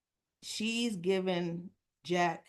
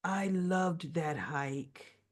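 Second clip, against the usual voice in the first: mock slow bluff; 2 semitones lower, much slower than usual. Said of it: "And she goes".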